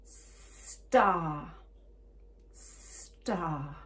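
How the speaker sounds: STAR with a long held S and AR; drawn-out "star" for careful listening; careful word demo. Shown as a demonstration. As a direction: stretch out the S in STAR. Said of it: "listen carefully".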